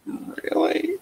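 high-pitched, disbelieving one-word reaction; incredulous. Acted. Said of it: "be like".

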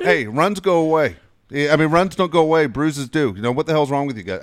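high, loud mock reprimand; joking coach scolding. Baseball coach ribbing the players with his old coach's line, joking scolding. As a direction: call it out as a jokey coach's telling-off. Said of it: "I say it as a joke".

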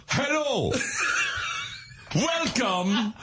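high, deliberate mock dictation; dad dictating. Dad first tries talk-to-text, high deliberate voice. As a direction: imitate a dad carefully addressing speech software. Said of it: "first time he tried to type something".

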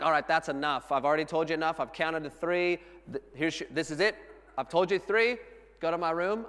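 loud mock scolding, rising in pitch; fed-up parent. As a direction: act out a parent laying down the law. Acted parent at end of patience.

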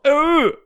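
disgusted grunt. Shown as a demonstration. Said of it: "I've done a shit look".

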